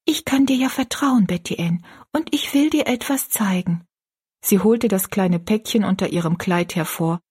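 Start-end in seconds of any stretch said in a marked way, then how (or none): 0.1-3.8 put-on high, confiding girl's voice with a half-whisper; low whisper. A narrator's reading. Rosalie shows her friend a secret, in a confiding half-whisper. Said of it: "in leisem Flüsterton".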